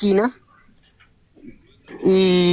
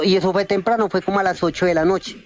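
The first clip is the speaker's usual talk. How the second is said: ordinary talk, nothing marked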